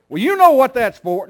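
high, loud mock parent voice; parent's retort. Parent retorting to the child before a whipping. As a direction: throw it back like an exasperated parent.

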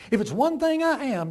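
a lightly voiced proud Southern lady; Mrs. Turpin. Preacher voicing the smug farm wife, lightly. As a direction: play her pleased with herself.

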